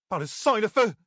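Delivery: very high-pitched startled cry; outraged exclamation. A narrator's reading. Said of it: "s'écria Doessine". (cri indigné, très aigu)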